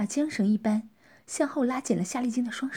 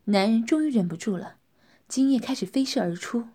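ordinary delivery, nothing marked

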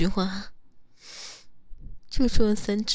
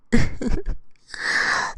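laugh; 4 semitones higher, 5 dB louder than usual. Real, not acted. Playful laugh after play fight.

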